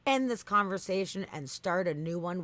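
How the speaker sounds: hammed-up scolding, leaning hard on each key word; stern ultimatum. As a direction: lay down the law as an angry bot. Acts out chatbot's ultimatum.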